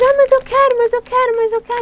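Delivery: put-on high, pushy child begging for candy; high childish whine; whiny kid. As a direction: beg like an impatient little kid. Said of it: "Eles vão falar assim".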